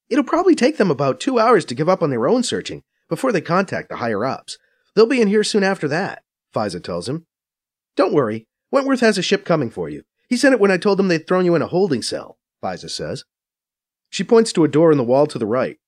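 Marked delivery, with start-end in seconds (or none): 0.1-6.2 higher, brisk Faiza voice, a bit scornful; Faiza explaining. A narrator's reading. 7.9-12.4 higher, brisk Faiza voice, a bit scornful; Faiza explaining. A narrator's reading. Faiza reassuring.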